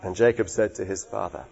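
normal talking voice

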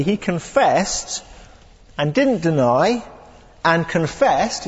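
slow, each confessed and deny leaned on; emphatic triple confession. Shown as a demonstration. Preacher stressing John's emphatic confession, slow. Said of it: "it's kind of three times".

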